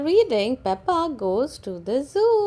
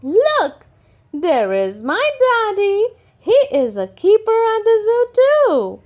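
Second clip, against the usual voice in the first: much higher, louder rushing voice; child proudly pointing out daddy; excited child; 11 semitones higher, 10 dB louder than usual. Acted. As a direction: blurt it out proudly. Child shows her daddy excitedly.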